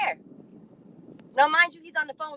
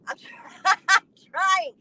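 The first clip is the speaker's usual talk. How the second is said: real laugh cutting the words, higher and slower; breaking into laughter; 6 semitones higher, 5 dB louder, slower than usual. Real, not acted. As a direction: try to speak through a laugh. Cracks up telling it.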